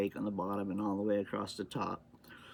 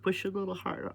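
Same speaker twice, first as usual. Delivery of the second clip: mock helpful; 9 semitones higher, faster than usual. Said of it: "And I said".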